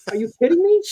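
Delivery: can't believe what he just heard; incredulous. Real, not acted. Outraged at the general's order.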